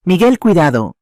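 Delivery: high, loud cry of warning; alarmed shout. A narrator's reading. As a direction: call out urgently to stop someone. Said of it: "gritó Elena".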